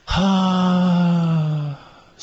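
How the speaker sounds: long, even, loose "haa" on the out-breath; relaxed voiced sigh. Shown as a demonstration. Teacher showing the class a loose breathy hum. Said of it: "せーの".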